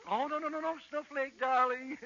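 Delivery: high, syrupy fussing; hammed-up tender protest; cooing doting husband. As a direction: coo it like an overdoting husband.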